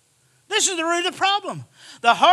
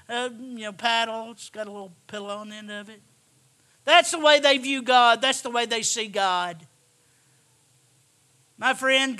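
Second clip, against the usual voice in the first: usual speaking voice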